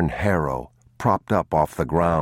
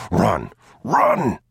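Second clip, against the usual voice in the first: urgent shout; 5 semitones higher, 4 dB louder than usual. A narrator's reading. Father urging flight.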